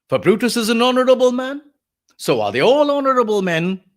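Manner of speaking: high, loud mocking praise; sarcastic. Lecturer as Antony praising the conspirators, sarcastic oration. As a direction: pitch it up and make the honour sound false. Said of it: "The sarcasm is evident".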